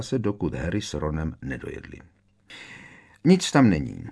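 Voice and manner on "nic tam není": plain reading voice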